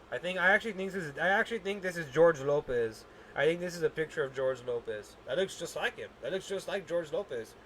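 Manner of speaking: usual speaking voice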